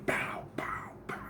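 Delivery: breathy pow pow; whispered punch sounds. Retold or imitated. Imitating punches to the face.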